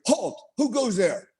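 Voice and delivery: sentry halting him in the dark, slow challenge. Retold or imitated. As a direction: call out like a sentry at the gate.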